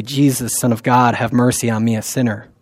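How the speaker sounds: hushed, humble prayer; soft prayer. Pretend. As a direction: say it quietly as a plea. Preacher voicing the Jesus prayer.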